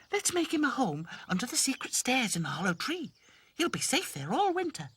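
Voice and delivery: high sweet doll voice. Acted. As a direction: chirp it brightly. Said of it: "said Lucy".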